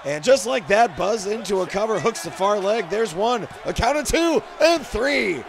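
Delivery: loud, high ringside call of the pin; live play-by-play. Acted. Wrestler calling his own in-game win, loud and high. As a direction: call the three-count like a hyped announcer.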